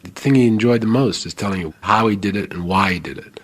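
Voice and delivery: usual speaking voice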